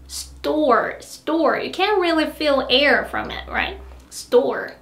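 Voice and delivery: store with no puff on the t; English store unaspirated. Shown as a demonstration. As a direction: keep store airless. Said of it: "tense consonants are like T in store".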